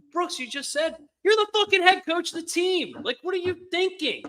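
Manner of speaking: high-pitched, incredulous venting; exasperated disbelief. Real, not acted. Fan venting to his co-host about the head coach, exasperated. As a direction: plead at the top of your range, fed up.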